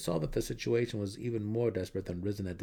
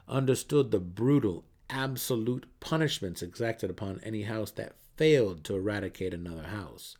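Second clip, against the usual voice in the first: ordinary delivery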